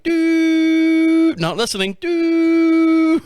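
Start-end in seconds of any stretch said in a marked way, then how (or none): 0.0-1.3 high, loud mock "doo" of a mind going blank; a dumb, tuned-out hum; blank brain noise. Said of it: "my brain literally went". 2.0-3.2 high, loud mock "doo" of a mind going blank; a dumb, tuned-out hum; blank brain noise. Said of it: "my brain literally went".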